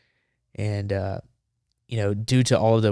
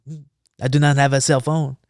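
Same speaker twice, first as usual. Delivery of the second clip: careful mock-French denial, pitched up; bad French accent; 4 semitones higher, 4 dB louder than usual. French reporter denying he has a phone, bad French accent. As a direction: imitate an evasive Frenchman. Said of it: "if it's a bad French accent".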